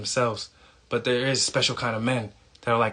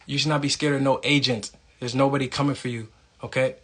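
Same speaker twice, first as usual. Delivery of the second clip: ordinary talk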